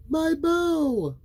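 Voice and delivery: put-on high, loud little girl. A narrator's reading. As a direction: proudly show off her bow. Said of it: "she said".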